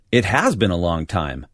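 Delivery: "has" punched, the rest light; stress on has. Acted. Jack agreeing with Marion, stressing "has". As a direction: lean on "has" to agree it's been ages. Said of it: "Listen to the emphasis he puts on the auxiliary verb has".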